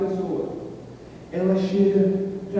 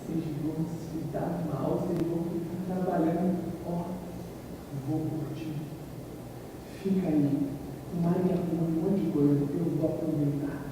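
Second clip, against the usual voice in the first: softer, smug teasing; gloating taunt; 3 semitones lower, 8 dB quieter than usual. Retold or imitated. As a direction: rub it in to the friends who stay behind. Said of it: "fala assim". (provocação presunçosa em voz mais baixa)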